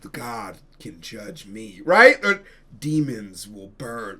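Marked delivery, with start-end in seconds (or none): 0.0-1.8 low, slow and much softer; a slow, brooding, ominous movie line, low and soft; Nicolas Cage voice. Retold or imitated. Imitating Nicolas Cage's movie line. 2.8-4.2 low, slow, ominous line; slow, brooding movie-hero threat, held low; Nicolas Cage voice. Retold or imitated. Imitating Nicolas Cage's movie line.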